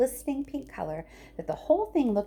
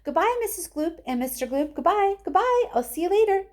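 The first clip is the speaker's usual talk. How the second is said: goodbyes climbing higher and louder; cheery rising farewell calls; singsong goodbye; 11 semitones higher, 3 dB louder than usual. Acted. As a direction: wave them off, each goodbye higher. Wonka calling cheery goodbyes.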